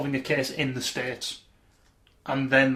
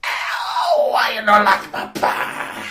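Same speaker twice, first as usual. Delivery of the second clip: slow, loud, high mock Russian whine; wounded Russian; 7 semitones higher, 7 dB louder, much slower than usual. Quoting the sketch's officer, a loud high mock Russian lament. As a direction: wail in a hammed-up Russian accent, deeply offended. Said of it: "He just sits there going".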